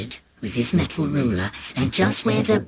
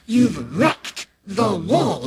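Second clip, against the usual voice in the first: slow, drawn-out, higher accusation; aghast, pitch raised; appalled dad; 4 semitones higher, much slower than usual. Acted. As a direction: stretch each word in horrified disbelief. Dad realises the wall is ruined.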